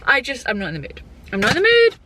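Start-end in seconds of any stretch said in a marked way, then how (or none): 1.3-2.0 much higher, louder whine; hammed-up protest. Vlogger refusing the drive to Lidl, in mock protest. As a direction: repeat it as a mock tantrum, pitched way up.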